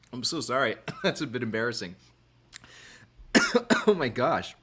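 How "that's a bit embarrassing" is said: coughing through an embarrassed apology; real cough from a drink down the wrong pipe; coughing fit. Real, not acted. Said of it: "That really went down the wrong pipe".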